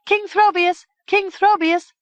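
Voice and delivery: high, loud calls for the king; crying out. A narrator's reading. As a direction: call across the meadow to the castle. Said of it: "cried out".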